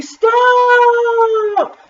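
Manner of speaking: high, long-held yell; very loud yell. A narrator's reading. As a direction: hold the word in a high yell. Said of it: "Taya yelled very loudly".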